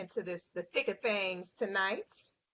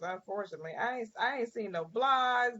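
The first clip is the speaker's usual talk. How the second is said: ordinary delivery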